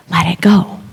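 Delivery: whispered into someone's ear. Retold or imitated. Yoga teacher to a struggling student, whispering. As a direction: imitate a teacher's hushed whisper. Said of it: "she whispers in my ear".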